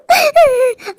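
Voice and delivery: high broken sob. Acted. Mother weeping before her son, high sob. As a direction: let out a crying wail.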